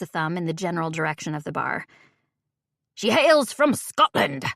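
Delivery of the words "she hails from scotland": slow, hammed-up Scottish brogue, pitched higher; exaggerated Scottish brogue. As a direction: lay the Scottish brogue on thick. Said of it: "he finishes with an exaggerated brogue".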